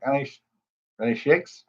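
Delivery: ordinary delivery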